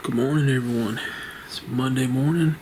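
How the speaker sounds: ordinary talk, nothing marked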